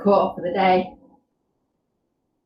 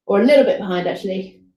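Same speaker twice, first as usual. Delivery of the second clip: ordinary talk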